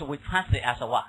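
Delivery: usual speaking voice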